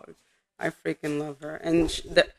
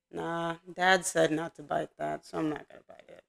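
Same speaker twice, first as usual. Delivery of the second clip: dog's thoughts; 7 dB quieter than usual. Retold or imitated. Voices the obedient dog's thoughts.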